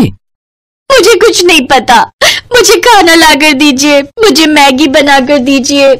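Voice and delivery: slow, high, drawn-out mock wail; whining demand. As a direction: beg tearfully, stretching every word. Wife wailing for more food.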